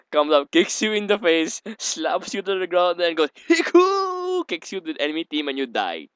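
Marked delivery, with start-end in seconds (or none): 3.5-4.5 much higher, loud kung-fu yell; mock battle cry. Imagined Lily kicking an enemy, high loud battle cry. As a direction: shout it like a martial arts kick.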